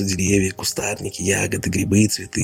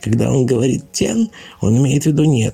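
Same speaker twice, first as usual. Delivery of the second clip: glib sly explainer; 7 semitones higher than usual. Pretend. Laban explains away Ludwig's cry to the bunnies, higher and slick. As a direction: talk fast cover for the brother.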